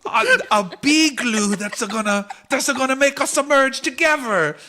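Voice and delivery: high, loud frantic bee; panicked bee voice. Acted. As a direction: blurt it out in alarm. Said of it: "one of the bees says".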